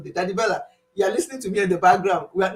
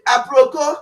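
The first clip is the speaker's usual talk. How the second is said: excited greeting; 4 semitones higher, 6 dB louder than usual. Retold or imitated. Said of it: "She will say".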